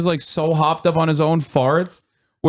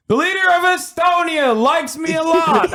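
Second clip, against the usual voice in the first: much higher, lightly voiced brag; mock presidential boast; mock Biden boast; 11 semitones higher than usual. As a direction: preen like a proud old politician. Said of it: "even Biden wasn't like".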